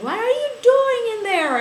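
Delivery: high, loud calling through a door; brothers calling out. Retold or imitated. Brothers calling through the locked door, mock suspicious.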